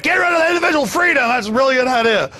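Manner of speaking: high, fast mimicry of an excited public; hammed-up eager citizen; mock enthusiastic crowd. Mocks everybody's eager agreement.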